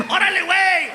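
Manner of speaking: high, hollered Spanish reply; kitchen crew shout. Acted. Kitchen guys answer the page, high acted shout. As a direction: yell it like a rowdy cook.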